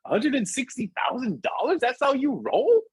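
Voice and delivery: high-pitched mock amazement at the price; playful astonishment. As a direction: tease a friend in exaggerated disbelief. Teasing over the costly car.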